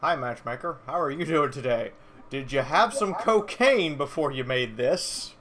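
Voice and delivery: slow, loud mock politeness; sarcastically sweet, slow and loud; sarcastic. Mocking the matchmaker.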